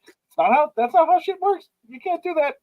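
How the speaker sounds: high, incredulous protest; mock outraged critic voice; mock exasperated objection. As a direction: squeak out the disbelief. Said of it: "I'm like".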